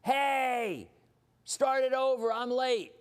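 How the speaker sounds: hammed-up rude yell at the projectionist; mock all caps yell. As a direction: shout up at the booth like an entitled latecomer. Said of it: "all caps".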